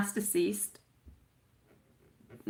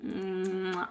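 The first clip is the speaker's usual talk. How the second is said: ordinary talk, nothing marked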